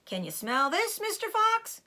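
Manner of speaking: high taunting call down the hole; mock shout over a chicken. A narrator's reading. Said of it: "he shouted".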